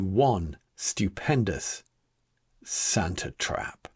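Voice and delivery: slow, soft, sinister hush; hushed reveal. A narrator's reading. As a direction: draw it out like a dark secret. Narrator savours the reveal.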